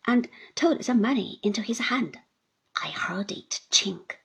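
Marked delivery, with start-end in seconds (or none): none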